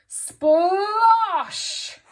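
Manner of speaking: high, very loud, drawn-out splosh; big exclamation. A narrator's reading. Teacher leads children reading together, huge exclaimed shout. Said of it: "Big capital letters and an exclamation mark".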